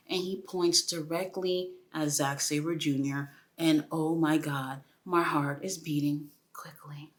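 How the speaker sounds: real excited whisper; hushed thrill at the staredown; breathless whisper. Real, not acted. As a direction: whisper it, heart racing.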